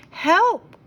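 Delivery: high, loud cry of help; Mary overwhelmed, calling for help. A narrator's reading. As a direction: call out in alarm. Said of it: "Mary cried".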